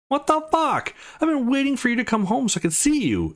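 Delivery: high-pitched, exasperated scolding; indignant outburst. Acted. Girl scolding Jack for showering first, indignant. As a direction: let the pitch jump up in outrage.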